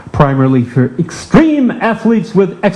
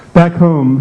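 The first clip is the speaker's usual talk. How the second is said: ordinary talk, nothing marked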